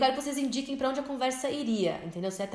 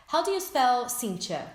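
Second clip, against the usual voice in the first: slow model question; 2 semitones lower, slower than usual. Shown as a demonstration. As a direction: spell out the model for students. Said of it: "você tem que perguntar".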